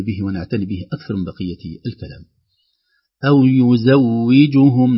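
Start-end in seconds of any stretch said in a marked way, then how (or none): 3.2-5.0 "أو يزوجهم" slow and louder, every vowel clear; careful recitation. Shown as a demonstration. Teacher recites every vowel carefully. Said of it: "لكثرة الحركات".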